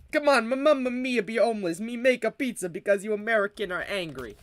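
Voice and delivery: hammy broken-English Italian plea; mock Italian accent. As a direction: beg in a put-on Italian accent. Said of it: "That's what Zombified said".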